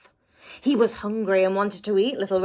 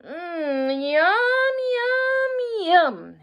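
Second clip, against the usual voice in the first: much higher, gleeful smacking yum; greedy wolf yum; 9 semitones higher, much slower than usual. A narrator's reading. Wolf relishing swallowing Grandma, high and gleeful. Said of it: "he said".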